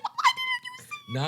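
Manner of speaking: very high, stretched-out squeal; comic high-pitched protest; mock outrage. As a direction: wail it in squeaky indignation. Harry's outraged reply to relatives.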